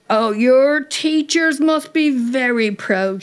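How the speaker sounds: sarcastic shout. A narrator's reading. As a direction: call it out slowly with scorn. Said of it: "she shouted".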